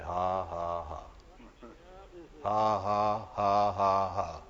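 slow, measured mock laughter in bursts; deliberate laugh. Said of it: "he just began to laugh".

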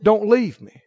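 ordinary delivery

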